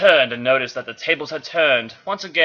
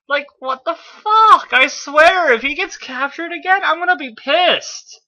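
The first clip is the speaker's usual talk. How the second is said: exasperated rant; 12 semitones higher than usual. Real, not acted. Reader venting at the character, high exasperated rant. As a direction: rant at the page in disbelief.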